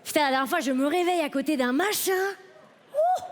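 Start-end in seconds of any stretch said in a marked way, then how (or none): 2.9-3.2 high surprised hoot. Retold or imitated. Waking up beside stranger.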